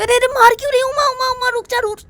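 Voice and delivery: high, loud cries of mock pain; older sister being hit with a pillow, high pained pleading. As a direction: beg her to stop hitting. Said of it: "उसकी दीदी बोलती".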